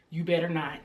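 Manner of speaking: low, hushed warning; quiet, low threat, retold. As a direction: say it under your breath as a warning. Retold warning to laughing boyfriend.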